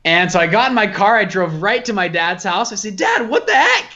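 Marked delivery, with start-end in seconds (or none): 3.0-3.9 much higher, half-laughing outrage at dad; shocked disbelief. Retold or imitated. Son bursting in on his dad after learning his wealth, shocked. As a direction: blurt it out, stunned and incredulous.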